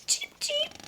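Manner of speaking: very high tiny cheeps; chick peeps. Acted. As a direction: peep like a newborn chick. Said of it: "the chicks peeped".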